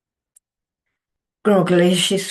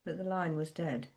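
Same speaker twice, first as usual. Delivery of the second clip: plain reading voice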